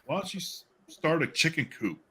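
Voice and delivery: ordinary talk, nothing marked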